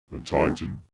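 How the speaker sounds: ordinary delivery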